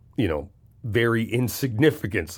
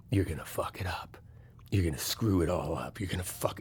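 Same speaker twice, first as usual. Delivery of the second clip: low, sneering whisper of the doubting voice; inner critic's whisper; 5 semitones lower, 10 dB quieter than usual. Pretend. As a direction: hiss the doubts like a taunting inner demon. Inner bad guy voiced.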